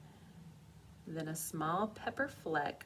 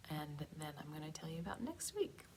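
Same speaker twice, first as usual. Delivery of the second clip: whispering; 7 dB quieter, faster than usual. Real, not acted. Aside to children.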